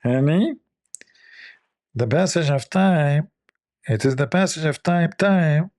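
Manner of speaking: mock her voice through the nose; drawn-out nasal imitation of a wife; Kamala Harris impression. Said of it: "she speaks from her nose".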